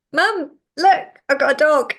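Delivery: put-on thrilled kid calling to her mum; excited teen. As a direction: burst through the door showing off the new dog. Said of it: "I was like".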